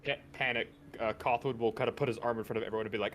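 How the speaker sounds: normal talking voice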